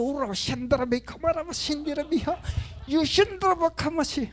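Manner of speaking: high, fervent tongues; speaking in tongues. Retold or imitated. Pastor showing how he spoke in tongues when furious, high fervent tongues. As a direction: burst into tongues to calm the anger. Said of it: "nagtangs lang ako".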